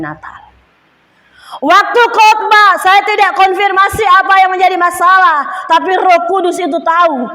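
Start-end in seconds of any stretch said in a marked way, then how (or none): 1.6-7.3 preaching burst. Acted. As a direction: drive the point home to the church.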